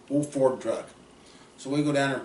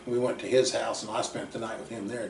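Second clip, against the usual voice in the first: normal talking voice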